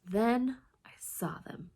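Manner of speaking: slow suspenseful whisper; hushed whisper. A narrator's reading. Tense moment, whispered.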